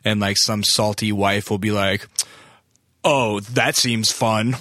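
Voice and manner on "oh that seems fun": slow, snide and sarcastic. Retold or imitated. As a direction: drip with fake enthusiasm. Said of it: "sarcastic eye roll".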